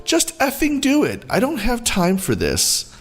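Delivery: high, pushy and impatient; voiced rude mother, fed up; entitled mom. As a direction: snap at the shelter worker. Entitled mom demands euthanasia.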